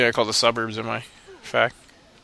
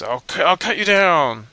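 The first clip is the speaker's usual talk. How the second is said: higher, slow mock swordsman menace; fake samurai threat; 6 semitones higher, slower than usual. A braggart samurai threatening the player, slow and hammy. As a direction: ham up a swaggering samurai's threat. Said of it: "keep saying like this".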